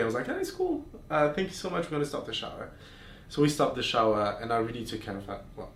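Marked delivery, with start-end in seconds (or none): 0.3-2.7 high awkward voice. Retold or imitated. Awkward reply to neighbour.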